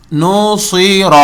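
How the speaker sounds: slow نصیر, sad thick; heavy sad. Shown as a demonstration. Teacher shows the heavy sad slowly. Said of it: "صا پڑھا جائے گا موٹا".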